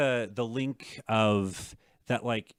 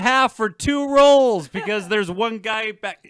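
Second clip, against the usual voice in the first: loud, slow, exasperated complaint; exasperated rant; 10 semitones higher, 9 dB louder than usual. Real, not acted. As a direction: vent the frustration loudly. Angry rant about sushi wait.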